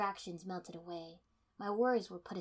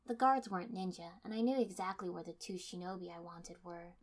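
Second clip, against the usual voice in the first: ordinary delivery, nothing marked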